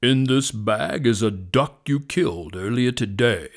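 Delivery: loud, grave lion voice; serious lion. A narrator's reading. Lion shows hunter the evidence, serious. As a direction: state the charge with heavy authority. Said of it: "with a serious voice".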